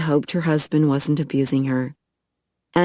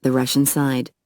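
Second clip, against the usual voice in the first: usual speaking voice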